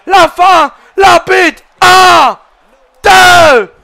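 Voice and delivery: hyped French wrestling commentator calling every move; live play-by-play. Acted. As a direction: call the match like a ringside announcer.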